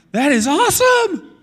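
preacher thrilled by the risen body; excited. Real, not acted. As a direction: let the wonder explode out. Preacher marvels at resurrected body.

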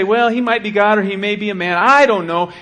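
hammed-up shrug of an unbeliever; mock doubter. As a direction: toss off the excuse with scorn. Mocking a doubter's excuse.

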